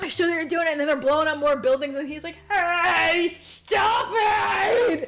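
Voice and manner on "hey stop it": loud, higher-pitched comic yell; mock monster protest shout. Retold monster to its attackers, indignant mock shout. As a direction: yell it like a pestered monster, half laughing.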